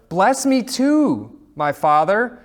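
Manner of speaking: loud, high, drawn-out plea; bitter cry. A narrator's reading. Wronged son begging his father's blessing, loud bitter cry. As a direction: cry it out high, stretching the words. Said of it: "with a loud and bitter cry".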